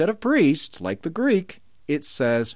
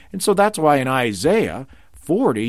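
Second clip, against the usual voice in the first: ordinary delivery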